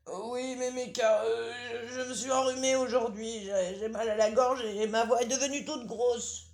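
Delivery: wolf pretending to be the granddaughter; wolf faking girl. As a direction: coax like a sly wolf playing innocent.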